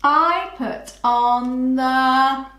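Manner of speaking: very slow, word by word; slow rereading. Shown as a demonstration. Teacher rereading slowly to find the next word. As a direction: reread the sentence slowly while writing. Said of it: "Leave a space".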